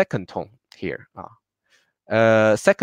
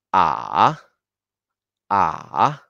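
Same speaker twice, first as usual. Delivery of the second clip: "A" sinking low, then rising; low dipping third tone; 4 semitones lower than usual. Shown as a demonstration. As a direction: drop "A" deep and swing it back up. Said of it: "muy bajo de tu voz".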